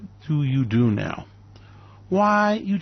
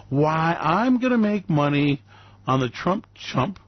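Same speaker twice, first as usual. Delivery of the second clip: normal talking voice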